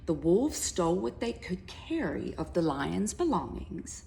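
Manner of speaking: ordinary delivery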